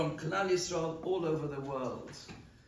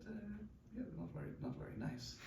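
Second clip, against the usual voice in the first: cautious; 12 dB quieter, much faster than usual. Retold or imitated. Traveller cautiously answers the officer at passport control, hushed and hesitant. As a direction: answer carefully, barely committing. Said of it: "So very cautiously I said".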